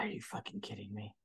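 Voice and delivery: low, quiet exasperated mutter; muttered disbelief. Retold or imitated. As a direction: grumble it under your breath. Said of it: "I was like".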